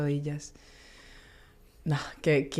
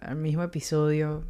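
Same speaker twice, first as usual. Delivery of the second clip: ordinary talk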